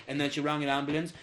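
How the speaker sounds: ordinary talk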